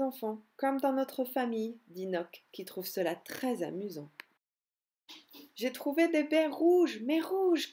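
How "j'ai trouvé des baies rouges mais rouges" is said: higher, louder eager shout; excited cry. Acted. Nock calling to the others about red berries, excited. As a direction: shout out the big find. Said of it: "crie Nock".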